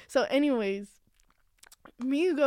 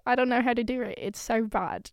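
ordinary talk, nothing marked

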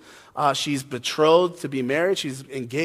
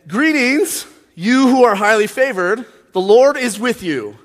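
higher, louder, stilted angel proclamation; socially awkward angel; 5 semitones higher, 5 dB louder than usual. Acted. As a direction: announce it like an awkward, weird angel. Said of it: "I'm doing a weird voice for Gabriel the angel".

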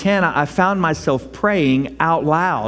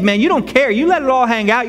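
ordinary talk

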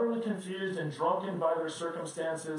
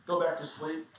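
ordinary talk

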